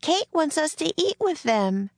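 high, sustained calling voice; high-pitched call to a friend. A narrator's reading. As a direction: call the news through the doorway. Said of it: "Annie called outside the hut".

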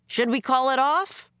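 a hint of an anxious officer; worried major. A narrator's reading. Major fears the mission is hopeless, worried.

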